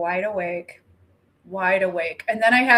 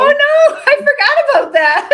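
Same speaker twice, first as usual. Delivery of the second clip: much higher, loud gasped alarm; real startled oh no; shocked; 12 dB louder than usual. Real, not acted. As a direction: yelp it out in real surprise. Sudden shocked recall.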